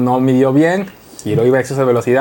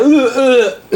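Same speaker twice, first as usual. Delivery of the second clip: high gagging retch; mock gagging; 11 semitones higher, 3 dB louder than usual. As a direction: fake a gag. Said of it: "empieza a imaginar". (arcada fingida aguda)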